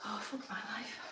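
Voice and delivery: breathy exhausted whisper. Real, not acted. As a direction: whisper it under your breath, spent. Exhausted groan during workout.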